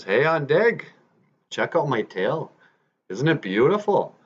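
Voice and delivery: high, swooping show-off beaver; proud boasting. Acted. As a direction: show off to the bear, pitch lifted. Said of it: "he was just proud of it".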